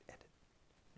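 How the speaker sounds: barely audible, breathy whisper; whispered plea. Retold or imitated. Viewer wishing the film would stop, hushed whisper. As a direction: whisper it under your breath to the screen.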